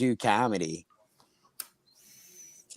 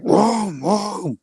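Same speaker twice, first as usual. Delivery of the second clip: two high, drawn-out whoas; high-pitched mock biker noise; imitated whooping noise; 9 semitones higher than usual.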